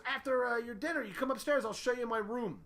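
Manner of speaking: a high, eager friend inviting them upstairs; Bob impression. Bob voice again.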